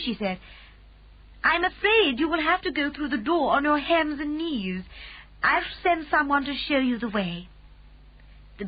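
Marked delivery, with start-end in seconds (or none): 1.4-7.5 a lightly voiced calm Queen, higher; gracious royal command, a touch higher. A narrator's reading. Queen speaks.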